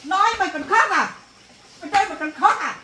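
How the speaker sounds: mother mocking her daughter's tears, angrily; angry scolding. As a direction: snap at her for crying.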